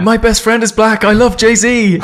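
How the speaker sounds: high, eager, gushing voice; gushing fanboy voice. Retold or imitated. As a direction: boast like a clueless fanboy. Mocking a clueless fake ally.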